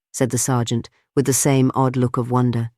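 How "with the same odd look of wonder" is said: ordinary delivery, nothing marked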